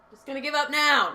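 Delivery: sudden high, loud mock exasperation; mock outburst. Singer joking about giving up on sounding original, mock outburst. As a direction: burst out in a joking shout of surrender.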